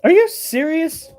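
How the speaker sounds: high, shocked question; disbelief. Retold or imitated. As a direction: sound utterly stunned.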